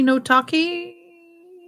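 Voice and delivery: high, very slow singsong; singsong drawn out. Pretend. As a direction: stretch it out in a teasing singsong. Streamer repeating phrase playfully.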